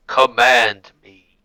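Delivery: slow, loud machine command; booming mechanical voice. Acted. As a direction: boom it out slowly. Ship speaks after a moment.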